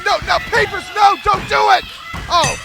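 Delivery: loud, high frantic play-by-play; excited commentator. Real, not acted. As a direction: yell at the referee to turn. Ringside chaos, shouting at referee.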